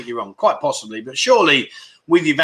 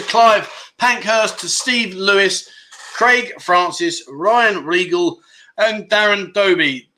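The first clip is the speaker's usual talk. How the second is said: slow name roll call; 4 semitones higher, slower than usual. Real, not acted. Welcoming new members by name.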